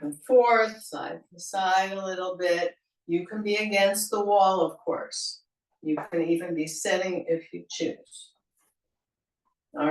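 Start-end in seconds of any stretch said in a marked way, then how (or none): none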